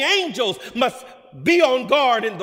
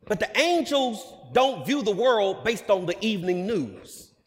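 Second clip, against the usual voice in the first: usual speaking voice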